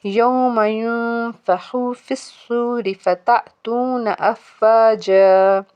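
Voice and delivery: slow Quranic recitation with long held vowels; measured teacher's recital, drawing out the ending; tajweed recitation. Shown as a demonstration. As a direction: recite the verse slowly and evenly. Recites the next verse.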